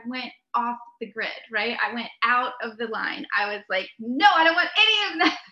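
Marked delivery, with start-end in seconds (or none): 4.0-5.4 laughing refusal. Retold or imitated. Laughing refusal of tourist lines.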